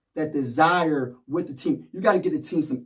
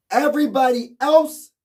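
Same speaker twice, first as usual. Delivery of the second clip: high, louder "Everybody else"; emphatic call; 9 semitones higher, 6 dB louder than usual. Real, not acted. Vlogger demanding the role players step up, emphatic. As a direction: push it out as a demand.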